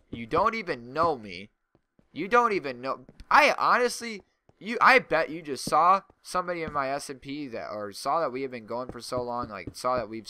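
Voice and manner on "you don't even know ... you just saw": louder, indignant scolding; heated scolding. Real, not acted. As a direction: tell them off hotly. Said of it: "That just makes me so angry".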